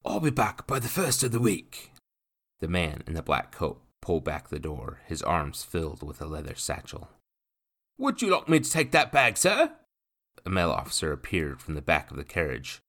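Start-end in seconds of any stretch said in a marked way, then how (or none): 0.1-1.9 man in burgundy ordering the courier, brash; brash gentleman. A narrator's reading. 8.0-9.8 high, loud helpful officer voice; officer offering to carry the bag, eager; eager young officer. A narrator's reading.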